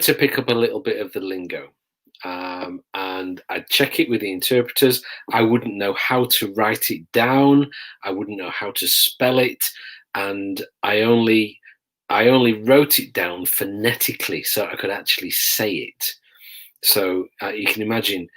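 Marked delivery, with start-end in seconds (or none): none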